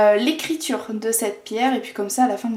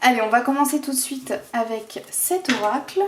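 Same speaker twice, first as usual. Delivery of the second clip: ordinary talk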